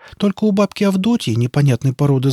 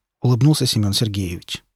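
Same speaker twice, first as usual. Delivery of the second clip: plain reading voice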